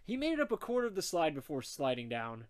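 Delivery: ordinary delivery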